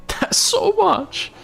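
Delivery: very high, very slow squeak of disbelief; amazed. Real, not acted. Streamer repeating his amazement at the viewer's playtime, squeaky and slow. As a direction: let it climb up and stretch, half laughing. Repeats reaction after laughing.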